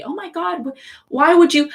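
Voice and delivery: high-pitched imitation of shocked critics; mock disbelief. Critics reacting to her love of Pluto, mock disbelief. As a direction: imitate someone aghast, pitched well up. Acted quote of people judging her.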